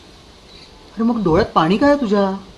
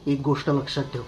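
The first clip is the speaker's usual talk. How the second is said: low threat; 6 semitones lower, 8 dB quieter, faster than usual. Acted.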